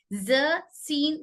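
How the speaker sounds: "ज, सीन" stretched very slowly, tongue tip against the teeth edges with a whistle; slow letter demo. Shown as a demonstration. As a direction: model "ज" and "सीन" slowly so the class hears the hiss. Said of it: "बस ध्यान रखिए कैसे अदा होता". ("ज, सीन" बहुत धीरे, सीटी की आवाज़ के साथ अदा करें)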